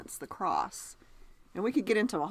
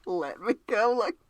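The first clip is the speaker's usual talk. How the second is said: laughing imitation. Host echoing co-host's Irish attempt, laughing. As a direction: echo the bad accent through helpless laughter.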